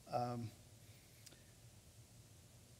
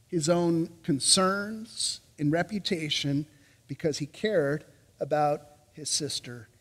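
ordinary talk, nothing marked

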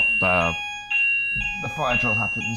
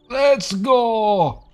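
excited shout; 9 semitones higher, 4 dB louder than usual. Real, not acted. Taking off on a broom.

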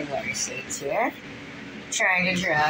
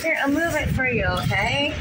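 hurried; 7 semitones higher than usual. Real, not acted. Hurried to cat.

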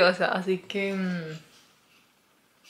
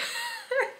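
high, quiet nervous giggle; nervous laugh; 7 dB quieter than usual. Real, not acted. Laughing at her own bad news, a high nervous giggle. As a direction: laugh off the nerves lightly. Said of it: "Me estoy riendo porque estoy nerviosa".

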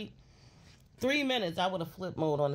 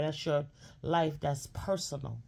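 ordinary talk, nothing marked

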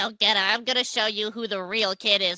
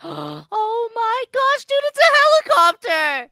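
much higher, louder gasp of delight; excited gasp; 10 semitones higher, 8 dB louder, slower than usual. Acted. Friend spotting the way home, high excited shout.

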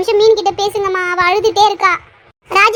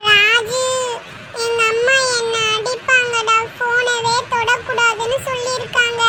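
put-on worried child; 3 semitones higher, 3 dB quieter, slower than usual. Child fears mother's beating.

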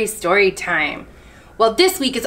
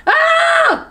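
short high scream; high shrieked "Ah" of fright; frightened scream; 4 dB louder than usual. A narrator's reading. Chameleon hearing lion is coming, high scream.

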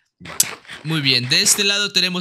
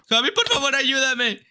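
desperate pleading; 7 semitones higher than usual. Real, not acted. Begs again for help in fight.

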